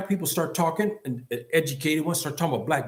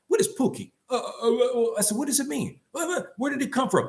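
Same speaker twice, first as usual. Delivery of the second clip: put-on gentle question to a child; 8 semitones higher, faster than usual. Elder asks a young boy what his name means, gently coaxing. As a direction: lightly put on, talking kindly down to a child.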